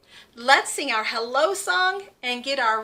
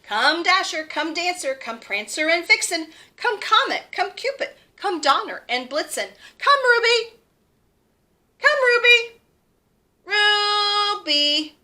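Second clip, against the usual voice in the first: rising calls, higher and louder; calling out; 5 semitones higher, 5 dB louder than usual. Acted. Santa calling his reindeer, louder on Ruby. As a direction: call each reindeer across the snow.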